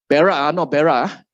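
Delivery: normal talking voice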